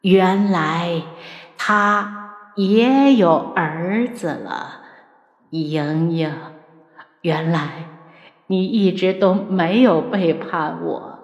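slow, halting, heartbroken villain; hushed, broken musing with long pauses; grieving realisation. A narrator's reading. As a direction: let it sink in, word by word. Dying villain grasps the truth, grieving.

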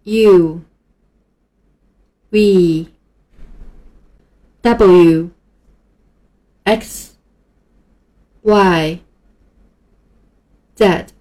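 each letter called loud and clear; "U V W X Y Z" read one by one as a model; review letter demo. Shown as a demonstration. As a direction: read the letters for the class to repeat. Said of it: "和我一起读".

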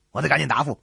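usual speaking voice

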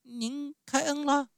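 very high, tiny clown voice; thin, timid voice. A narrator's reading. Clown asks whether he is pardoned, thin and timid. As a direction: squeak the question anxiously. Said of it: "声音细得好不容易才听见".

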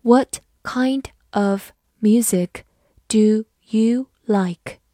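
slow, careful classroom read; slow model reading for learners. Shown as a demonstration. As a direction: read each word slowly and clearly. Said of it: "我们把第一句慢读一遍".

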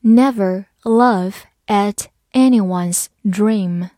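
"Never laugh at anyone's dream" slowly, word by word; slow reading. Shown as a demonstration. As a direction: leave a gap between words. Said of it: "慢读一遍".

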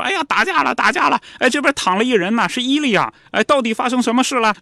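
high-pitched alarmed calling out; retold bystander shout. A bystander calls out behind Lev, high and alarmed. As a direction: cry out that a fight broke out. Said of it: "就听见身后有人就喊嘛".

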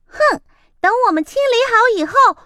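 高、快、响地吼; 猫气冲冲地大吼; angry cat roar. Acted. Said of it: "猫生气地大吼". (猫冲着离开的鸭子生气大吼)

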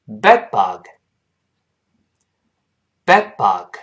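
"bed bug" with the d of "bed" held back before "bug"; unreleased d. Shown as a demonstration. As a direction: stop on a silent d in "bed", then release into "bug". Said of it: "再读一下臭虫这个词".

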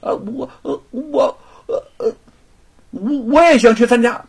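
high, halting stammer full of ums; hesitant stammer. Acted. Son nervously asking his father to come along, stammering. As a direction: stumble over every word, nervous son. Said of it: "吞吞吐吐地说".

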